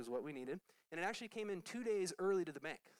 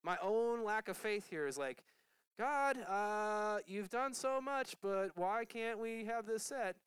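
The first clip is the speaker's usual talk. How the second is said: mild pleading prayer; 6 semitones higher, 5 dB louder, slower than usual. Retold or imitated. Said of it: "I'm starting to panic".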